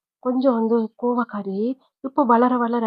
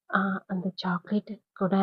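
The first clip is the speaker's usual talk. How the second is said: whispered, slow little ask; a timid child's hushed plea; shy whisper; 2 semitones lower, 5 dB quieter, slower than usual. Acted. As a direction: whisper the request shyly, like a small child. Little girl shyly asks for chocolate.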